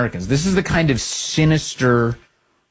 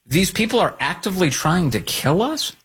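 higher, rising disbelieving question; incredulous echo; 6 semitones higher than usual. Retold or imitated. Host reacting to candidate's clip, incredulous.

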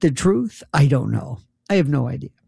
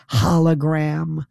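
ordinary delivery

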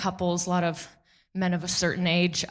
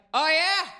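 put-on drunk challenger; 7 semitones higher than usual. Drunk guy retorts.

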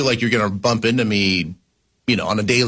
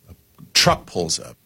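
normal talking voice

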